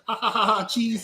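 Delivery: mock cackle. Mock laugh in retelling.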